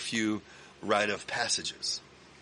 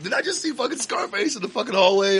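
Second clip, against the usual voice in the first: higher, louder and awestruck; excited disbelief; 12 semitones higher, 7 dB louder, faster than usual. Retold or imitated. Fan asking the band about Scarface, excited disbelief. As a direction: burst in unable to believe it.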